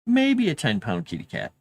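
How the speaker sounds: ordinary delivery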